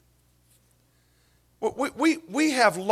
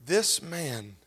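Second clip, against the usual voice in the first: normal talking voice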